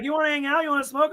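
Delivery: high-pitched, mocking eager invitation; mimicked young coworker. Manager mimicking his young coworker's invitations, high mock-eager voice. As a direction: imitate a kid asking to hang out.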